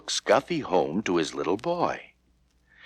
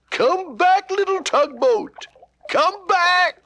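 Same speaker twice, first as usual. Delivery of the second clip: high, loud call after the boat; frantic call downstream; crying out; 5 dB louder than usual. Acted. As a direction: shout after it as it drifts. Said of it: "cried the man".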